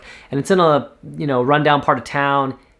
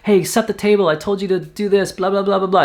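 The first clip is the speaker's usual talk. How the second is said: higher, half-hearted mock yell of a busy mom; 6 semitones higher than usual. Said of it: "screaming in some other language and yelling".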